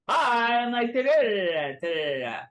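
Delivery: nonsense syllables mimicking bombast; silly, over the top. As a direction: start high, then tumble down. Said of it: "over the top".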